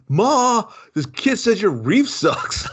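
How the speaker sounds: high, slow whiny call to mom; whiny tattling kid. Retold or imitated. Kid tattling to his mother.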